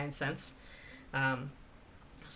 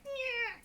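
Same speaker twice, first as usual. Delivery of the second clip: mock whine; 3 dB quieter than usual. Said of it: "it's like".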